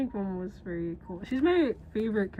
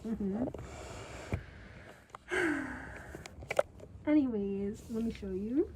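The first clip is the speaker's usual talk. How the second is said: normal talking voice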